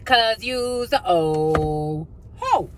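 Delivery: stretched, sing-song slow taunt of 'oh ho'. Pretend. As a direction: drag out every word like a teasing chant. Repeated drawn-out taunt.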